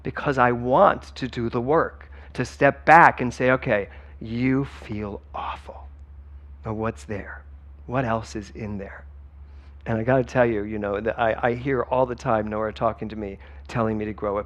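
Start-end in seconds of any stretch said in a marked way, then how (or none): 3.5-9.1 gentle self-talk. Acted.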